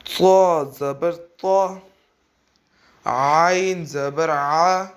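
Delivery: slow, sing-song syllable drill with a rise on each; measured Qaida recitation. Shown as a demonstration. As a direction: recite each letter slowly for pupils to copy. Recites for pupils to repeat.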